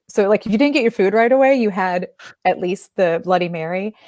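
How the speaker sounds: ordinary delivery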